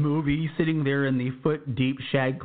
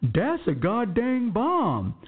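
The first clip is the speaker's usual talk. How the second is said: high, slow, drawn-out exclamation; Sergeant Fears; 10 semitones higher, much slower than usual. Retold or imitated. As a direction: put on a high voice and stretch every word. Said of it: "says in a clear voice".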